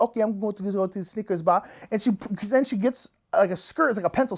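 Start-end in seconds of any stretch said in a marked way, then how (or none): 0.0-1.7 quick mock French-accented English; brisk French lady impression; put-on French accent, fast. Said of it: "this is my French accent".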